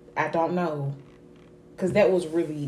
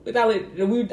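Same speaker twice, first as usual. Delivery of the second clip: ordinary talk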